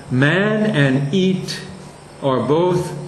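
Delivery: ordinary talk, nothing marked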